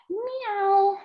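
high cute meow; kitty meow. Pretend. Teacher meowing for kitty cat pose. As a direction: squeak it like a kitten.

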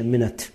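مینت softly with a schwa, "MIN-uht"; schwa pronunciation demo. Shown as a demonstration. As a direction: weaken the second vowel of مینت to "uh". («مینت» با شوا در هجای دوم)

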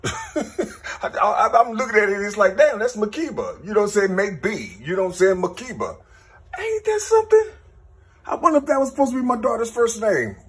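laughing in disbelief at what you found; high, laughing amazement at the daughter's name; excited disbelief. Real, not acted. Stunned discovery of daughter's name.

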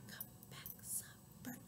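barely audible whisper; soft. Shown as a demonstration. Calling the soft bird back, tiny whisper. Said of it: "One named Soft".

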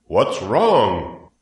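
startled public-address question; loudspeaker announcer. A narrator's reading. Announcer sees the pig collapse, calls out in loudspeaker voice. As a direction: boom it out like a fair announcer. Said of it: "asked the loudspeaker".

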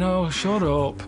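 slow, drawn-out scornful brush-off; dismissive wife. Retold or imitated. Wife brushing off his toothache, slow and scornful. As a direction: wave him off with scorn. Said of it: "She's going".

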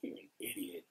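very low, soft mutter; hushed, dropped-pitch aside; muttered insult. Retold or imitated. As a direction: say it under your breath, with quiet disdain. Acted muttered reaction walking off stage.

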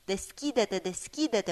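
ordinary delivery

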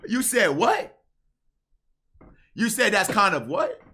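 high, loud disbelieving question; can't believe what he heard; incredulous. Real, not acted. Disbelief at her words.

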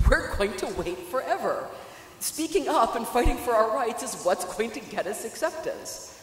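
loud, rising rally cry; passionate. Real, not acted. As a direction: push it like a protest speech. Passionate call to fight for rights.

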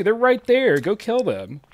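ordinary talk, nothing marked

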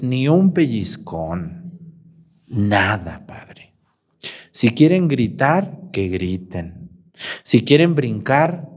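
low, slow imitation of a mother; permissive mother imitated. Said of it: "Me dijo".

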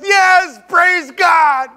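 joyful praise. Retold or imitated. Brother rejoicing after being spat on, loud and overjoyed. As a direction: cheer it out like rescued. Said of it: "and he goes".

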